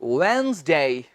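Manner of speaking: वेडनेसडे as slow two-beat WENZ-day, silent D; correct pronunciation. Shown as a demonstration. Teacher models वेडनेसडे for students, careful correct wenz-day with a long glide. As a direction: draw out वेडनेसडे as wenz-daay, falling. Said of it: "मैं सिर्फ बताऊंगा यहां पर".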